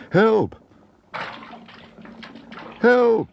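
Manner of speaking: loud, high cries for help. A narrator's reading. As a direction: shout for help, then again. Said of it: "and then much louder".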